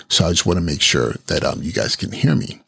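ordinary delivery

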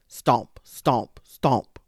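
loud, heavy stomping words; loud thudding stomp sounds. Acted. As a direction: pound out each stomp like hippo feet. Hippo stomping sound effect.

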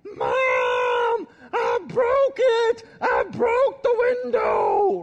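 put-on loud high frantic kid's yell, slowed; kid screaming. Eight-year-old boy yelling the confession to his mom. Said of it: "with a golf club screaming".

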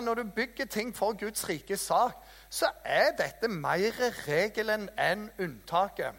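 ordinary talk

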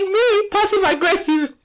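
high, teary-laughing disbelief; laughing outrage. Retold or imitated. A mother laughing at being called passive aggressive. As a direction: choke it out through laughter.

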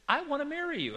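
high, loud lovestruck outburst; gushing excitement. Retold or imitated. Husband to his wife after her suggestion, gushing. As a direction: gush it in a hammed-up high voice.

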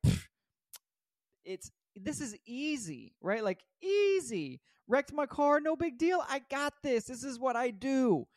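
high, stretched, breezy self-assured boast; cocky bravado. Retold or imitated. Telling himself a wrecked car is no big deal, cocky and airy. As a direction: brush it all off like nothing can touch you.

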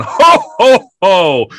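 high, loud jolly Santa laugh. Retold or imitated. Santa doll laughing in the night. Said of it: "I hear Brad going".

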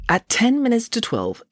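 ordinary delivery

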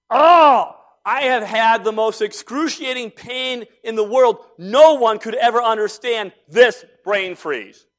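milk it like a martyr for sympathy; overacted anguish over a brain freeze; mock suffering. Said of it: "the standing joke is".

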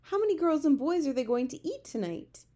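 high, loud worried question; alarmed question. A narrator's reading. Sophie asks about children in danger, alarmed.

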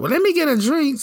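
high mock girly voice; mimicking the woman. Voicing the woman at the bar.

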